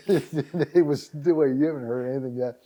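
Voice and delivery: talking through a laugh. Real, not acted. Storyteller amused by her own sudden success, laughing. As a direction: let the laugh run into the words.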